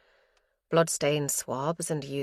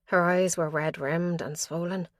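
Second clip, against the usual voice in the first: usual speaking voice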